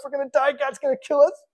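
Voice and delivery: hammed-up terror of a man on the ground; mock panic. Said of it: "the dude freaks out".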